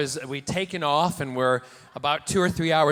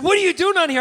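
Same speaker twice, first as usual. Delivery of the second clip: surprised fan; 13 semitones higher, 6 dB louder, faster than usual. Retold or imitated. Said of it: "And he said".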